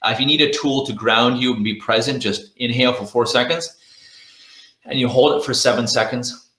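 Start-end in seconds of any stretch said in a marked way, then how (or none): none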